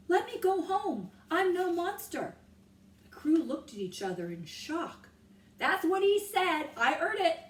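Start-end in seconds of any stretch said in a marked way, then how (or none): 0.1-2.4 loud, high quick retelling; ringing voice. A narrator's reading. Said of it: "Annie's voice rang through the silence". 5.6-7.4 loud, high eager claim; excited sailor. A narrator's reading. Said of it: "one man said".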